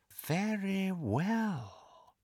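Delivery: soft, very slow, silky menace; silky danger. A narrator's reading. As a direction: let the threat slide out softly and slowly. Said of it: "more danger in the silkiness of his voice".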